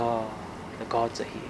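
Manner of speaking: softly, in hushed awe; awed hush. Retold or imitated. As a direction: breathe it out as a quiet realization. Woman realizes the guests are gods.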